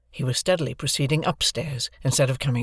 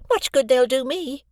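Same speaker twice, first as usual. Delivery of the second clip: much higher, sniffy; grudging sister; 11 semitones higher, 3 dB louder than usual. A narrator's reading. Mrs Joe receiving compliments, sniffy and high. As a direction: grumble but pleased.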